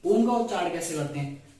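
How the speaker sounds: ordinary talk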